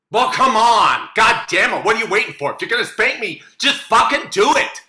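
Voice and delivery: loud exasperated demand; angry impatient. Acted. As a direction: snap at him to get on with it.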